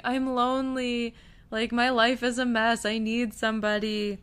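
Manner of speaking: mock lonely plea. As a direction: plead lightly, mimicking his self-pity. Voices the professor pleading as he flees.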